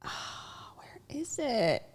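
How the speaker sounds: muttered whisper while searching. Real, not acted. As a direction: whisper to yourself while looking. Searching phone quietly.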